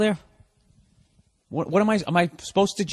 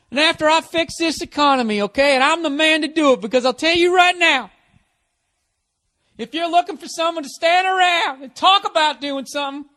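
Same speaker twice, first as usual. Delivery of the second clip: loud, high, strained stump-speech bellow; blustering candidate; 11 semitones higher, 4 dB louder than usual. Acted. Candidate boasting to voters about jobs and economy, blustering. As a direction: hammer it out like a cocky politician on the podium. Mock campaign speech by a man.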